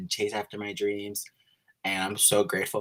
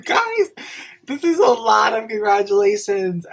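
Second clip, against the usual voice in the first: much higher and louder, laughing; high, loud, laughing disbelief; overwhelmed and excited; 11 semitones higher, 9 dB louder than usual. Real, not acted. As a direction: swept up by all the congratulations. Overwhelmed by congratulations.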